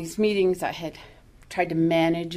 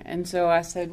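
ordinary delivery

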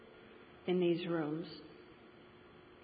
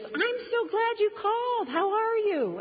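much higher, delighted gush; gushing friend; 13 semitones higher, slower than usual. Retold or imitated. Jan picking up the call, gushing in a much higher voice. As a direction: squeal with delight that she finally called. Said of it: "And she goes".

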